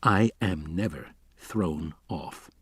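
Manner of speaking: slow, low and soft, icy; cold. A narrator's reading. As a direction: shut him down coldly, slowly. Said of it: "Levine said coldly".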